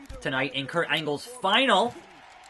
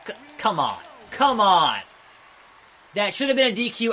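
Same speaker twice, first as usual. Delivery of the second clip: loud exasperated come on; frustrated gamer shout; frustrated outburst; 4 semitones higher, 4 dB louder than usual. Real, not acted. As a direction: yell at the unfair game. Frustrated at game.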